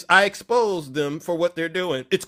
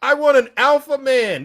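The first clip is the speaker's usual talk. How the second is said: higher, louder mock demand for an alpha man; sing-song imitation of a woman wanting an alpha man; mocking conservative women; 9 semitones higher, 9 dB louder than usual. Host mocking conservative women to his live audience. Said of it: "This is how they talk".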